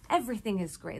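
ordinary delivery

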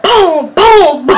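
loud, high booms broken by laughter; imitated kicking thuds. Drunk passenger kicks the back seat, loud imitated thuds. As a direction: punch out each boom like a kick.

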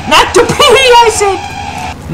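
high, playful insistent holler; insistent yelling. Acted. Gamer insisting to Flappy Face on the title, insistent yelling. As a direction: pitch it way up and draw out the last repeat.

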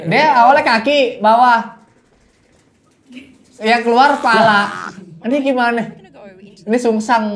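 high, loud, incredulous commentary; amused astonishment. Real, not acted. Viewer puzzling over the slider coming out head-first, amused astonishment. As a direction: exclaim high and loud, near laughter.

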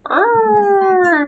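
high, loud drawn-out "urrr"; kittens' growl. A narrator's reading. Three kittens answering their mother, high playful growl. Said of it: "they scratched and they growled".

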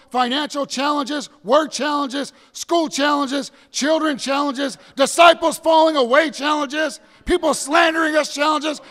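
high, driving preacher list; rousing sermon cadence; preaching. Acted. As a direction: build the list from the pulpit.

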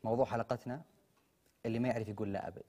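host announcement. Acted. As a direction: sign off to the viewers.

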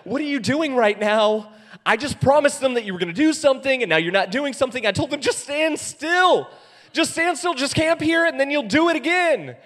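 high, loud mock outrage; hammed-up frantic complaint to God; exasperated protest. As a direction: demand answers, voice climbing high. Acts Moses panicking at God.

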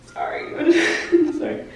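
teary laugh, voice catching and high; emotional laughing apology. Real, not acted. Thanking viewers, she chokes up and laughs through tears. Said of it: "I'm just emotional".

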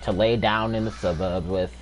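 Morgan Freeman narration. Acted. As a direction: narrate like a calm documentary voice. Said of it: "Morgan Freeman back here".

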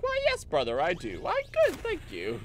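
polite clone answering his brother; a lightly done prim clone. Retold or imitated. Clone dialogue acted out.

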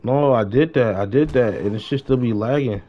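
usual speaking voice

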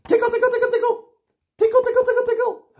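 very high, loud tickle squeal; tickling squeal. Shown as a demonstration. Host tickling the monster's feet, very high squeal. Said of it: "can you tickle your little one's feet?".

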